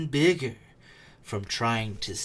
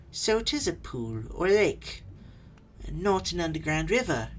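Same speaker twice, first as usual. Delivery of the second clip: Bilbo thinking aloud; 7 semitones higher, 3 dB louder than usual. Acted. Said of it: "he thought".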